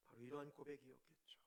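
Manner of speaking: soft intimate whisper. Real, not acted. Preacher whispering the conclusion to the congregation. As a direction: draw them in with a whisper. Hushed sermon emphasis.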